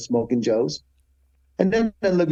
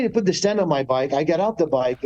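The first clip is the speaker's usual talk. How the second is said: ordinary delivery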